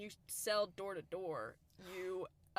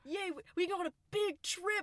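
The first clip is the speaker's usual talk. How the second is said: high-pitched mock cheering; put-on giddy delight; excited celebration; 11 semitones higher, 4 dB louder than usual. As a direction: cheer like a thrilled young scout. Acting out thrilled trip celebration.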